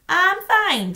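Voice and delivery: put-on a girl faking that all is normal; forced normal. A narrator's reading. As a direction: try hard to sound fine. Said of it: "trying her best to sound normal".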